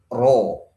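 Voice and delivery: RO with a full rounded o; rounded O vowel. Shown as a demonstration. Teacher showing the forbidden reading, RO with o. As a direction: round the lips fully on RO. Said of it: "pakai o".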